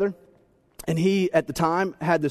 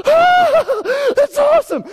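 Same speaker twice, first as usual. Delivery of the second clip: very high, loud delighted shout; thrilled; 7 dB louder, much slower than usual. Retold or imitated. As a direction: squeal with joy at new email. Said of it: "and you're like".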